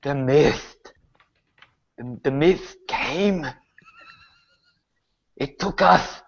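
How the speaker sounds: halting words with long pauses; revived corpse. Acted. Dead Hazel answers what happened to her, haltingly. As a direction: speak in fragments, as if barely back from death. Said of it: "brought her back for a few moments".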